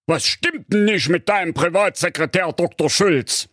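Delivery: put-on a puzzled old king raising a complaint. A narrator's reading. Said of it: "sagte der König".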